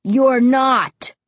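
loud, firm menace; threatening. A narrator's reading. Ray forbidding the cemetery trip, threatening. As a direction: lay it down as a warning. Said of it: "His voice was so low, so threatening".

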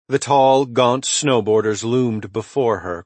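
ordinary delivery

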